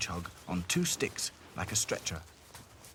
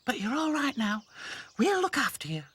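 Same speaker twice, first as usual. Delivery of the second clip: high, gentle reassurance; reassuring; 4 dB louder than usual. Acted. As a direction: comfort him kindly. Lucy reassuring hedgehog.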